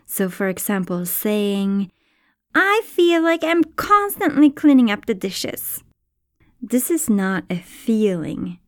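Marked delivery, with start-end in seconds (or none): 2.5-5.8 high, put-upon complaining voice; odd put-on voice. Shown as a demonstration. To a partner about chores, a high complaining whine. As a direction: whine it like a fed-up housemate. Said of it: "I don't know why I said it with that voice".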